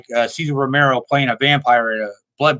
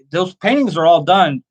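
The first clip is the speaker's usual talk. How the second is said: normal talking voice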